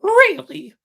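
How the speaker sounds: very high, loud scoffing puppet voice; high puppet voice, mocking doubt. A narrator's reading. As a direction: scoff at it like a cheeky boy. Pinocchio scoffs.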